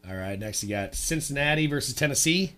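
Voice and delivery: ordinary delivery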